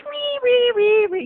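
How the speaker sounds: very high, loud, carefree squeals; innocent little girl. Retold or imitated. Little girl pedaling toward the door, innocent squeals. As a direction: squeal like a happy child on a bike. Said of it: "as innocent as can be".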